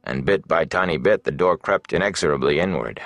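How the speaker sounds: plain reading voice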